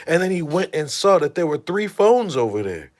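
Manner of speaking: ordinary talk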